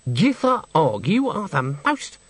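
indignant, drawn out, then cut off; scolding. Acted. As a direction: start a scandalised telling-off. Granny scolds Nanny, cut off.

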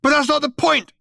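loud, high-pitched outrage; raging. A narrator's reading. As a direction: burst out in indignant rage. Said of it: "raged Ford".

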